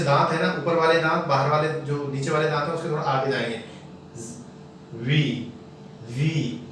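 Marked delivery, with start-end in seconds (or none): 4.9-6.6 "वी" with teeth on lip and air escaping; lower, soft buzzing v in "वी". Shown as a demonstration. Teacher demonstrates the letter V, soft friction. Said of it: "वी को थोड़ा softly बोलते हैं".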